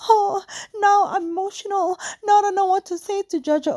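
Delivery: high, whiny mock-emotional voice; mocking a weepy friend. Mimicking the friend crying for Jojo, high whiny. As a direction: whimper it like a fake crier.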